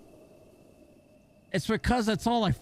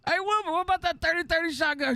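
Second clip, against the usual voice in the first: high, fast teasing jab; mock teasing; 12 semitones higher, faster than usual. Friends ribbing Wilbur about his story, in a high, fast teasing voice. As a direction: rib Wilbur in a high, quick sing-song. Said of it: "We gave him so much shit".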